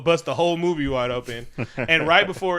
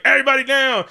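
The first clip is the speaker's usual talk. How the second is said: much higher, louder barked order; commando shout; 11 semitones higher, 8 dB louder than usual. Retold or imitated. Corporate soldiers storm the club, shouting orders. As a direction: storm in yelling at the crowd. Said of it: "and they're like".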